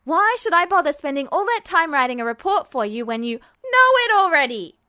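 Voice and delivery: loud, exasperated yell. A narrator's reading. Edward leaning across the couch to yell at Mustang. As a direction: let it all out at him. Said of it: "to yell at Mustang".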